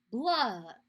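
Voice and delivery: plain reading voice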